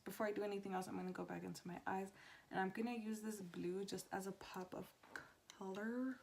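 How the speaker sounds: soft, hushed talk; quiet at night. Real, not acted. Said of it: "talking a lot lower than I usually do".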